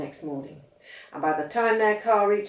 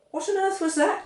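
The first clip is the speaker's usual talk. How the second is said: much higher, alarmed question; startled; 12 semitones higher than usual. Acted. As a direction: jump at a sudden noise. Loud noise outside startles.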